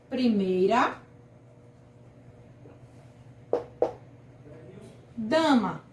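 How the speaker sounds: "Primeira" then a long pause before "dama", both clearly dictated; spaced-out example word. Shown as a demonstration. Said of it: "o exemplo aqui também é primeira dama". (ditado pausado: "Primeira", pausa longa, "dama")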